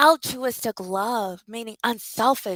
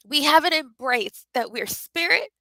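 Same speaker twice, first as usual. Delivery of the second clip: ordinary talk, nothing marked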